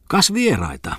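high-pitched burst of surprise at guests; suddenly noticing visitors, pitch jumps up; sudden exclamation. A narrator's reading. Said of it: "sanoi hän sitten yhtäkkiä". (yllättynyt huudahdus)

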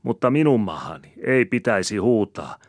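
high, loud tirade; angry scolding. A narrator's reading. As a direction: quarrelsome wife railing back. Woman scolds back in quarrel.

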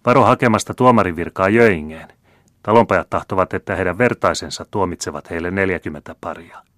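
loud mocking warning; jeering shout. A narrator's reading. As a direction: shout it over the crowd. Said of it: "huusi kolmas".

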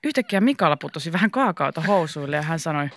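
ordinary delivery

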